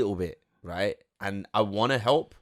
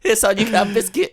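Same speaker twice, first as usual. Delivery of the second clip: mum's teasing line, much higher and louder through laughter; laughing mock mum; 13 semitones higher, 8 dB louder than usual. Voicing mum mocking him while laughing. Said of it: "She goes".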